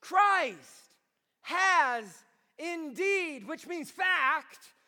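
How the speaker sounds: slow, loud, high declaration; emphatic proclamation. Acted. Preacher proclaiming Christ's resurrection to the congregation, slow booming. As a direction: boom out every word.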